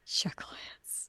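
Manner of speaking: slow whispered echo; a hushed, savoring whisper. Real, not acted. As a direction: breathe the word out slowly.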